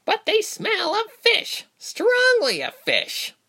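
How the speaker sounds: very high, drawn-out creature voice; creature's cry. A narrator's reading. One of the pack objecting, high cry. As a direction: cry out in protest, high and slow. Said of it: "one cried".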